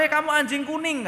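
high, loud scolding call; mock angry teacher. Sunday school teacher snapping at a naughty boy, loud and high. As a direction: yell the insult like a fed-up teacher.

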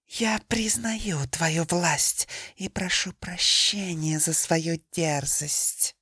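slow, submissive whispered apology; humble whisper. Acted. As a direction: whisper it meekly, defeated. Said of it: "смиренно проговорила змея".

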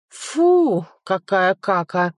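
a huge beast spitting out bad food; disgusted giant voice. A narrator's reading.

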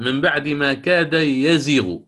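slow recited reading with yazighu; Hafs reading. Shown as a demonstration. Teacher reciting Hafs's reading to students, slow and measured. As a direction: recite من بعد ما كاد يزيغ slowly with the ya of يزيغ. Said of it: "قرأ".

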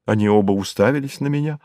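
plain reading voice

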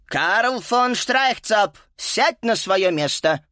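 slow, high, firm command; stern teacher. Acted. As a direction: order him to sit, slowly. Teacher ordering pupil.

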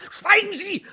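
laut und hoch: Schweigen Sie!; scharfer Befehl. Acted. Streit im Hörspiel. (scharf und laut angefahren)

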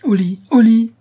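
loud, high barked command; shouted threat. A narrator's reading. Man cracking whip at the old woman, shouting. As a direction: shout it to drive someone off. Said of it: "répéta celui-ci".

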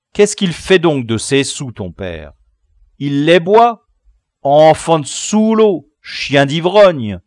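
loud, grumbling abuse; indignant grumbling. Acted. Leader cheated of a garter, loud indignant grumbling. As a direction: grumble out insults at full voice. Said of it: "non sans grommeler".

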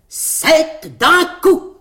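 very slow, high, loud proclamation; proclamation triomphale. A narrator's reading. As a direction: announce it like a slogan in big letters. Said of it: "broda dessus en grosses lettres". (proclamé lentement, fort et haut)

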